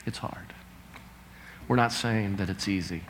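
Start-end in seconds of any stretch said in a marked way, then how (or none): 0.1-0.5 soft whisper, much softer; hushed, heavy-hearted; whispered admission. Real, not acted. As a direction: breathe it out quietly. Hushed empathetic aside.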